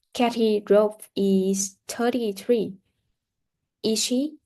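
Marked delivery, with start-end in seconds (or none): 3.8-4.4 short surprised question with a rising tune; surprised Is she with a high rise. Shown as a demonstration. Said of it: "short questions with rising intonations".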